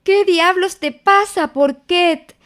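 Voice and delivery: ask, startled, just out of bed; startled question. A narrator's reading. Christopher Robin to panicked Piglet, alarmed question. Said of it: "dijo Christopher Robin".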